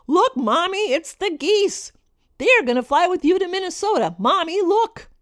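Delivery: put-on high, bright-eyed child's voice pointing at the sky; a six-year-old thrilled by geese; excited little girl. Daughter calling to her mother, excited child's voice. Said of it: "got really excited and exclaimed".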